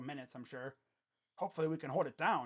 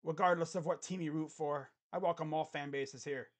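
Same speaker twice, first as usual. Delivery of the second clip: ordinary talk, nothing marked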